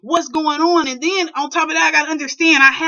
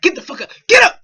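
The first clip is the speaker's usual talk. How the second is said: loud, higher barked order; retold furious yell, loud and high; angry dad yelling; 7 semitones higher, 7 dB louder, faster than usual. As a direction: yell at him to get up.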